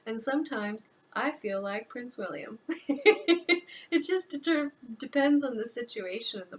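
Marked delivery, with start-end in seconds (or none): 2.6-3.8 high bright burst of laughter; real laugh breaking through. Real, not acted. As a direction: laugh out loud at the story. Reader laughs at her own story.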